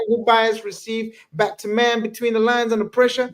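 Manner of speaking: put-on high-pitched American accent. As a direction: read it like an American commentator. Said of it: "I gotta do an American accent".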